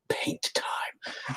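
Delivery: whispered aside. Real, not acted. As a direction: breathe it out in a whisper. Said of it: "whispered announcement".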